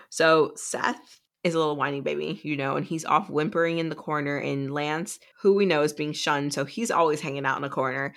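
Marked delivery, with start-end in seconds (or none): none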